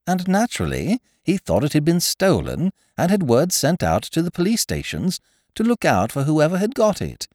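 high-pitched, earnest character voice; lightly voiced defensive friend; Looney explaining. A narrator's reading.

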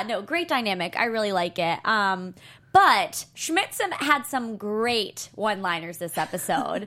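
ordinary talk

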